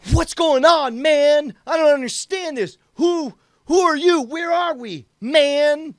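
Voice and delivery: feigned exasperation. Pretend. Player confronting the mysterious driver with feigned exasperation. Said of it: "I feign exasperation and say".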